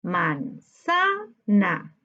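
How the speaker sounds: "Manzana" slowly split into man, za, na; very slow, segmented "Manzana"; syllable by syllable. Shown as a demonstration. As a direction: sound out "Manzana" in three separate syllables. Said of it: "separemos por sílaba manzana".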